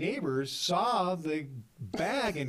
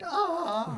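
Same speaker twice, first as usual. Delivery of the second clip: high mock wail of panic; freak-out cry; 4 dB louder than usual. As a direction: let out a pitched-up freaked-out "ah". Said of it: "other than freaking out, going".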